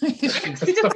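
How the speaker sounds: laughing high and loud. Real, not acted. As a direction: laugh out the word Accident. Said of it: "Freudian, Freudian there".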